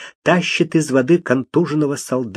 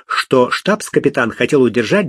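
ordinary delivery, nothing marked

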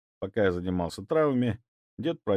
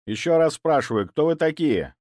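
stern demand; 8 semitones higher, 6 dB louder than usual. A narrator's reading. Repeated demand, louder.

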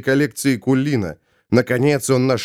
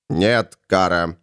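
low, slow refusal; measured, deep-set voice; calm Ravenor; 7 semitones lower, slower than usual. Acted. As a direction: refuse slowly, pitched well down. Ravenor refuses her plea.

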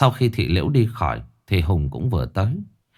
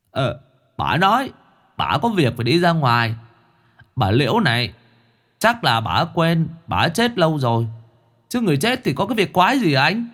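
higher-pitched, puzzled reply; companion's voice; 6 semitones higher than usual. Acted. Hải answers Hùng about the missing ghost, higher puzzled voice. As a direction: pipe up higher, ending on a questioning rise.

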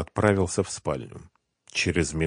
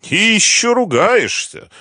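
high, slow, satisfied taunt; 9 dB louder than usual. A narrator's reading. Gangster to the groaning captive, satisfied. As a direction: draw it out loudly, pleased with yourself. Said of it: "удовлетворённо сказал Митя".

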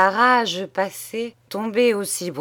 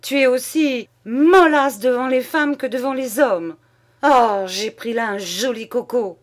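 higher, louder mocking imitation of a gruff old soldier; mimicking Cachelin; 5 semitones higher, 5 dB louder than usual. Wife mocks husband in her father's voice, mocking. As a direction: ape her father's coarse manly bluster. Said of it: "trouvé soudain la voix et les gestes de Cachelin".

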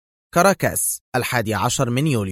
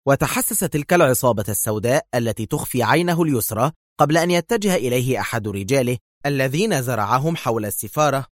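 ordinary delivery

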